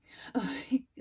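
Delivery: a loud, high burst of incredulous laughter; a sharp laugh of disbelief at the bank; disbelieving laugh. Real, not acted. Laughing at bank's fraud flag.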